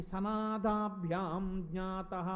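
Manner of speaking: slow, low Sanskrit quotation; scripture recitation. Retold or imitated. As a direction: stretch each syllable of the verse. Chanting quoted Sanskrit verse. (శ్లోకాన్ని నెమ్మదిగా సాగదీసి చెప్పడం)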